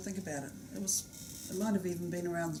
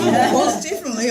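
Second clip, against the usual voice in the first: loud laughing reply; 7 semitones higher, 15 dB louder than usual. Real, not acted. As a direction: laugh while you answer. Laughing at the arm question.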